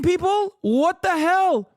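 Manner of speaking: high, slow disbelief; incredulous. Real, not acted. Streamer ranting at Israel's marketing, incredulous. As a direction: let it climb in outrage.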